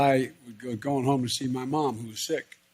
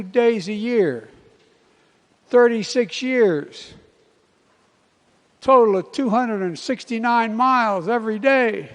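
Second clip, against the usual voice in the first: animated retelling; 9 semitones higher, 5 dB louder than usual. Retold or imitated. Conductor voiced, animated.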